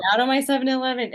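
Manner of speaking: a quick cartoonish Indian accent like the Simpsons clerk; mock Indian accent. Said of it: "in the Indian accent".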